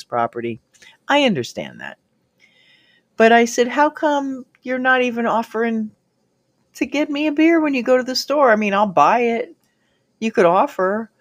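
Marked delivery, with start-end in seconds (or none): 3.7-11.1 higher, fast indignant challenge. Retold or imitated. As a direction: press him, rising indignation. Confronting him about beer.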